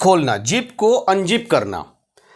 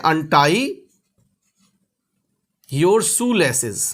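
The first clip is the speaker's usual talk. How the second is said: each word drawn out very slowly; slow model phrase; 2 semitones lower, much slower than usual. Shown as a demonstration. Teacher slowly models English for Hindi learners. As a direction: say it slowly so the class can copy. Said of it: "teacher models English phrase".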